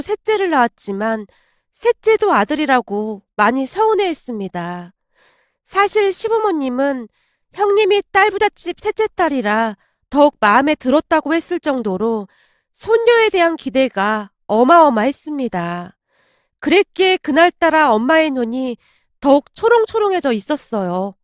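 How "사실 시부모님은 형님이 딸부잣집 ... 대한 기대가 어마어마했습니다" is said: plain reading voice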